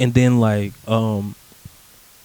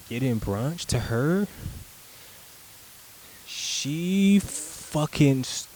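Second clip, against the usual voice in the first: ordinary delivery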